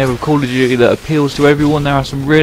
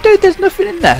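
mock innocent. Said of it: "And we went".

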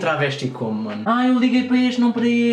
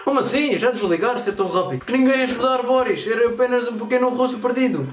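put-on broken-Portuguese Russian accent; 4 semitones higher than usual. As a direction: play a lost Russian, heavy accent. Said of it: "com a famosa voz de russo".

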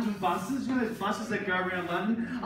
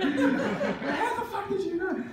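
stunned; 10 semitones higher, slower than usual. Real, not acted. Amazed at girlfriend's insight.

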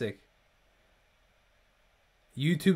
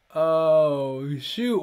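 laughing; 5 dB louder than usual. Real, not acted. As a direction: caught off guard, cracking up. Cracking up at another joke.

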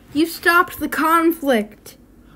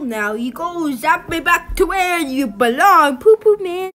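normal talking voice

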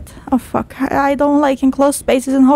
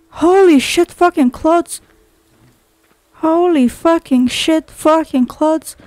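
ordinary talk

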